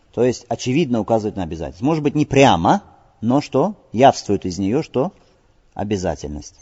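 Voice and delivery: ordinary delivery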